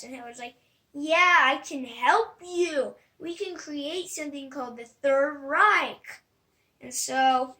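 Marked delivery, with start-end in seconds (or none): 0.9-6.2 loud, slow, grand dictator's promise; confident promise. Retold or imitated. Hitler answering the hopeful Germans with a loud slow promise. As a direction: draw it out like a big pledge to the crowd.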